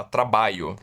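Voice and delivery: "trabalho" with a y for lh, tra-BA-yo; popular pronunciation. Shown as a demonstration. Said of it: "Quer dizer, uma pronúncia assim". ("trabalho" dito "trabaio", fala do povão)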